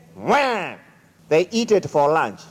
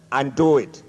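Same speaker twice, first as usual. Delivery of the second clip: low, curt brush-off from the goat; dismissive goat; 6 semitones lower than usual. Acted. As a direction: drop low and shut him down.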